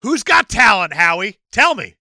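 loud, high spoken-word tirade; mock confrontation. Singer bashing Howie on an album, mock-confrontational. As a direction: hurl it as a fake angry demand.

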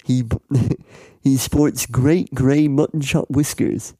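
ordinary delivery

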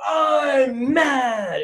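high, loud mock toddler yell; angry toddler shout. Toddler son denied a waffle, shouting that he's mad. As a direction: shout it like a furious two-year-old. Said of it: "he'll go".

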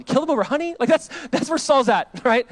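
can you believe this, loud and high; incredulous. Real, not acted. Pastor mocks Saul's threat to his son, incredulous. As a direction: pitch leaps up in disbelief. Incredulous aside about Saul.

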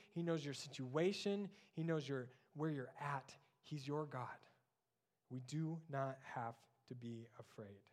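usual speaking voice

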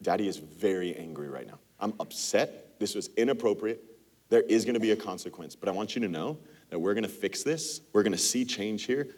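put-on low, soft, steady, firm but reassuring to the child; a father calmly setting a consequence; calm firm parent. Shown as a demonstration. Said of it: "To be able to say".

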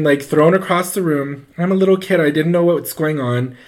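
ordinary delivery

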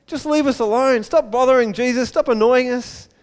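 high-pitched mock complaint; annoyed crowd. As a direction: tell a nuisance off impatiently. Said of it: "One minute they're saying".

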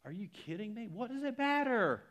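very high, rising into a loud cry; exasperated disbelief. Retold or imitated. Preacher scolding gossip watchers, high and exasperated. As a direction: throw up your hands in disbelief. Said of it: "I'm like".

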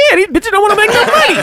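squeaky high cartoon quote; high-pitched cartoon impression; high mock cartoon voice. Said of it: "He said".

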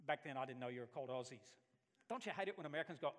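ordinary talk, nothing marked

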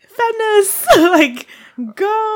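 high excited cheer; cheering squeal. Pretend. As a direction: squeal it like a fan. Cheering on women gangsters.

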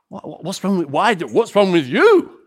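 loud, high, baffled demand; can't believe what he's hearing; incredulous. Retold or imitated. Preacher mock-confronting the one unimpressed fan, loud disbelief.

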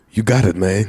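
lightly voiced mumbly cool guy; lazy Benicio del Toro. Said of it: "as portrayed by Benicio del Toro".

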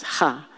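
"ha" with a rasped throat kh; guttural last sound. Shown as a demonstration. Teacher showing the last root sound, "ha" as throaty kh. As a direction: scrape the back of the throat on "ha". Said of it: "the last sound is".

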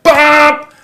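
loud high mock car horn blast; a sudden loud beep in imitation; imitated car hooter. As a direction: honk it out like an angry driver. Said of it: "hooted, like".

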